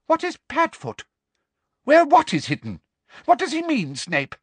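grasping for the secret; Umbridge's voice. A narrator's reading. Umbridge presses Snape about Padfoot, eager and high.

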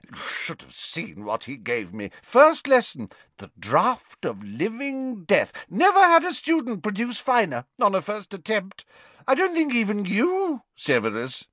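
put-on high, hearty old professor voice; a fond, tipsy potions master; jovial Slughorn. Tipsy teacher praising Harry to Trelawney, high and hearty.

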